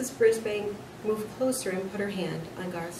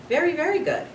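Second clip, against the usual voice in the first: high, loud self-praise; a boastful little hamster bragging; proud boast; 10 semitones higher, 8 dB louder, faster than usual. Acted. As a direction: crow about it proudly. Hamster boasting of his plans.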